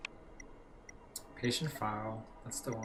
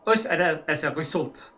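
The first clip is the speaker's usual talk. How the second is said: hammed-up German accent, louder letter reading; mock German accent, slightly raised pitch; 3 semitones higher, 5 dB louder than usual. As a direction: read the letter as a stiff German doctor in a German accent. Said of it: "let me do my best German".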